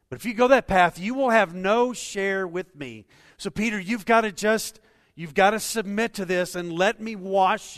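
high, loud, pressing plea; loud warning. Acted. Jesus warning Peter to submit, loud and high. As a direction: warn Peter with full force.

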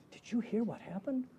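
soft, hushed gossipy question; nosy neighbour sharing news under the breath; gossip on the grapevine. Acted. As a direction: pass the rumour along quietly. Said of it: "the grapevine. You know".

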